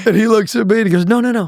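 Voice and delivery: high, fast, through laughter; pastor's denial told through laughter; laughing while telling. Real, not acted.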